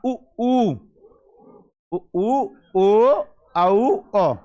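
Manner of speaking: उ, ऊ, ओ, औ sounded out one by one; vowel demo. Shown as a demonstration. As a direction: say उ ऊ ओ औ slowly with rounded lips. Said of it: "जैसे अपन ने कहा".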